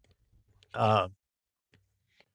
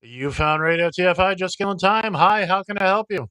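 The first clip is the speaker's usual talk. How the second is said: upbeat host greeting callers on air; bright on-air welcome, a bit higher and louder; radio announcer; 5 semitones higher, 5 dB louder than usual. Acted. Radio host answers the call.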